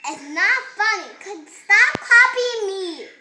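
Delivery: loud, stress on copying and funny; loud telling off. Real, not acted. Kid shouts at the bird that repeats him, loud and cross. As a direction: shout at the toy to stop.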